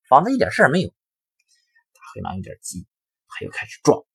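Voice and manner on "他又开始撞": usual speaking voice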